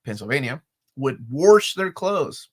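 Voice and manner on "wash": wash as "warsh", with an R; local pronunciation. Retold or imitated. Recalling Western PA locals, imitated pronunciation. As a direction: stretch wash into a Pittsburgh "warsh". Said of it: "It's not just Kentucky".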